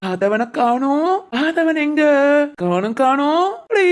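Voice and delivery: slow then rising playful peekaboo. Real, not acted. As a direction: drag it out, then pop up high. Peekaboo game with baby. (குழந்தையுடன் ஒளிந்து விளையாடும் உற்சாகம்)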